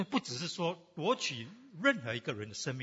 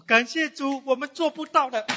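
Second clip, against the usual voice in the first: elder's words repeated in a much higher voice; preacher repeating the elder's thanks to the congregation; quoting the elder; 10 semitones higher, 7 dB louder than usual. Retold or imitated. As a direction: echo the elder's line brightly, with lifted pitch. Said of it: "郑长老也说什么".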